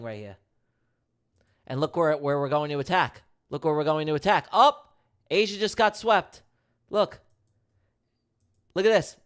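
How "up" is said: excited call. Real, not acted. Price sweeps, excited call.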